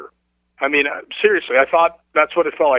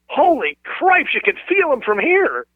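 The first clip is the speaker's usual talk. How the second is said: retold amazed cry, much higher and slow; startled exclamation; 11 semitones higher, slower than usual. Girl at the table as he walks by, amazed high exclamation. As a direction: imitate a girl stunned by the cold coming off him. Girl feels cold as he passes.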